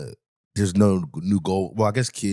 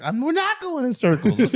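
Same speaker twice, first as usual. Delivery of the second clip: high-pitched mock protest; raised, exasperated imitation; indignant denial. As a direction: imitate her squealed objection. Retold quarrel, her high protest.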